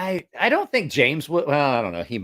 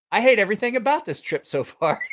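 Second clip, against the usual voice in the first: higher-pitched retold griping; exasperated complaint; 8 semitones higher than usual. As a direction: moan it in comic exasperation. Retelling own complaint.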